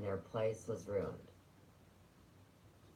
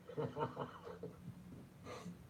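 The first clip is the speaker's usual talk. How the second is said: breathy, quiet chuckle; real laugh; 5 semitones higher, 9 dB quieter than usual. Real, not acted. Storyteller amused by the film scene, soft real laugh. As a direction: laugh softly to yourself.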